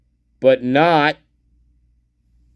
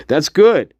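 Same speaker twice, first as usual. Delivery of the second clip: ordinary delivery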